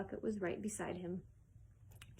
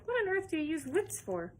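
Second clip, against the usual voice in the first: higher incredulous demand; indignant question; 7 semitones higher than usual. Acted. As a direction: demand an answer. Veruca demanding explanation.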